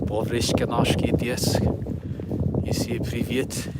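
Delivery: mock Russian accent with fake Russian words. Vlogger showing viewers his Russian accent, mock Russian accent. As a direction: play a jokey Russian in a thick accent. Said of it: "sometimes I do the Russian accent".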